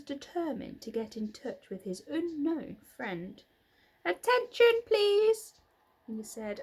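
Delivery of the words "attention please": much higher and louder call. A narrator's reading. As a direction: shout it out to the barn. Wilbur calls out again.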